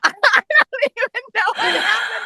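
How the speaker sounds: laughing in happy disbelief. Real, not acted. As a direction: client marvels at her own transformation, laughing. Client laughing at her change.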